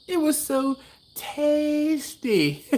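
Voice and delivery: high, slow mock Trinidadian voice quoting a kid taster; Trinidadian accent imitation. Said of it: "I do a horrible Trinidadian accent".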